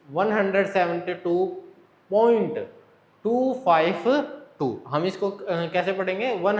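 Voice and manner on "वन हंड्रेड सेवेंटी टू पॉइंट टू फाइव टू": slow demo, "टू five टू" as separate digits. Shown as a demonstration. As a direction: pause around "point", then spell out "टू, five, टू" slowly. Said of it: "one by one".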